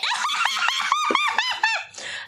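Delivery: high squealing swoon laugh; swooning maiden laugh. Pretend. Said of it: "It's my swooning maiden laugh".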